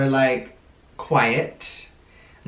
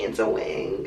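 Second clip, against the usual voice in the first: whispered, softer and lower; sheepish whisper; 4 semitones lower, 5 dB quieter than usual. Retold or imitated. Handing boyfriend the wig as an apology, whispered. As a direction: breathe it out like a shy confession.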